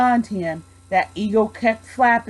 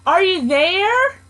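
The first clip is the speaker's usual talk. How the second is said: calling out; 8 semitones higher than usual. A narrator's reading. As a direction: call out searching. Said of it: "and called".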